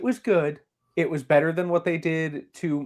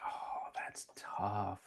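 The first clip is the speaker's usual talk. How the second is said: breathless whisper, much softer; whispered aside; 5 semitones lower, 18 dB quieter, much slower than usual. Real, not acted. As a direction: say it under your breath, stumped. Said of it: "unvoiced whisper".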